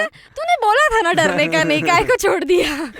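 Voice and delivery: loud, high exasperated retort; loud accusing. Retold or imitated. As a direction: throw it back at her loudly. Accusing friend who fled.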